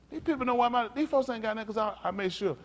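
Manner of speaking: high-pitched preaching warning; urgent preaching. Real, not acted.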